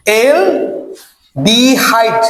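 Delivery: Aldehyde stretched very slowly in separate parts; drawn-out repetition. Shown as a demonstration. Teacher has students repeat the term, aldehyde drawn out slowly. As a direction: draw out aldehyde, pausing before the last part. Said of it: "কি থাকবে বলতো?".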